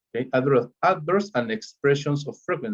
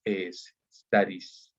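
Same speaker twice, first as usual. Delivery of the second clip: deletrea E S despacio y luego di studies; deletreo lento; 3 semitones lower, 4 dB quieter, much slower than usual. Shown as a demonstration. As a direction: marca cada letra muy despacio. (deletreo pausado de la terminación)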